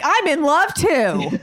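high, slow lovestruck voice; dreamy swoon. Retold or imitated. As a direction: swoon it out, laughing. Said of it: "And Alice is like".

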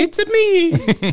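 slow, high fake Italian catchphrase; Mario. Co-host auditions as the character, fake Italian accent. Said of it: "fake ass Italian accent".

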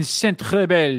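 slow, hammed-up French pronunciation; French accent. Echoes arena name in French.

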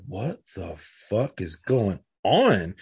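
very slow, drawn-out bewilderment; retold disbelief. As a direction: stretch each word out, dumbfounded by what you see. Retold bewilderment at chaotic scene.

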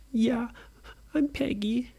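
put-on high, small girl's voice; little girl voice. A narrator's reading. Scared Peggy answering Brady, high and small.

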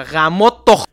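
sudden loud swearing, cut short; re-enacted yell of frustration; acted curse outburst. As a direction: explode into a curse and break off. Re-enacts cursing over spilled coffee.

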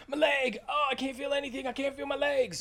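high, overacted cries of injury; shouting while wounded. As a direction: ham up a wrestler's leg injury. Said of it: "Best overacting ever".

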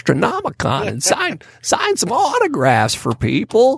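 high, slow put-on old man; creaky old-timer drawling slowly. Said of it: "my old man voice".

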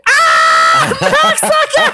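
shouted curse with a big pitch jump and laughter; mock angry shout. Retelling the startled grandma at the Safeway, laughing shout. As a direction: bark the curse while laughing. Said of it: "He's like".

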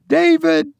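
high call in the wife's voice. A narrator's reading. Mother calling her dead son, calling out. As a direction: call the name out, pitched very high. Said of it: "She raised her voice".

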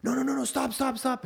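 high, urgent little rabbit voice; frantic rabbit. Acted. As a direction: squeak out a panicked stop. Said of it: "and he goes".

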